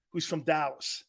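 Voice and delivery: ordinary talk, nothing marked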